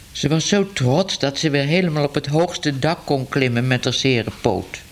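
usual speaking voice

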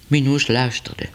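ordinary delivery

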